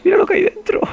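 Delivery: extremely high, loud squeal; shrill falsetto scream of alarm; panicked shriek. Real, not acted. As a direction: screech it in sheer panic. Sees monster inside, repeats in panic.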